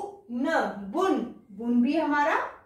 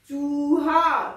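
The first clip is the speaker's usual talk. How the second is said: drawn out slowly, long ऊ held; slow sounding out; 5 dB louder, much slower than usual. Shown as a demonstration. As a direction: sound out चूहा for the children. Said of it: "teacher sounds out word".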